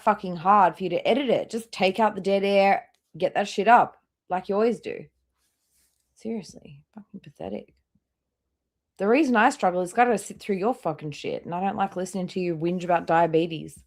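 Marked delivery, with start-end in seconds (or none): 6.1-7.7 hushed whispered jab; whispered contempt. Real, not acted. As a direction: breathe the insult under your breath. Muttered insult aside.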